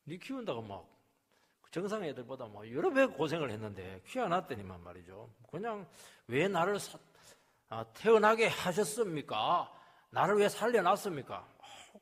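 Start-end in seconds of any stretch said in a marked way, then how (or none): 6.3-11.3 put-on protesting child. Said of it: "그렇게 막 항의를 하니까".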